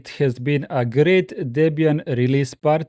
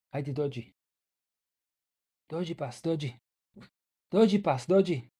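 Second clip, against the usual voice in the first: much softer coaxing calls; 9 dB quieter than usual. Real, not acted. Streamer softly coaxes his dog to come closer, quiet coaxing. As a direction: coax the dog gently, almost under breath.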